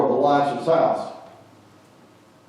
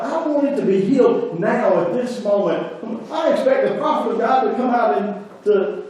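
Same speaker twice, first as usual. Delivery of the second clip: high, loud, put-upon outrage; a proud general fuming, pitched well up; indignant demand; 11 semitones higher, 4 dB louder than usual. Retold or imitated. Preacher voicing the angry general Naaman, indignant. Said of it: "he gets angry".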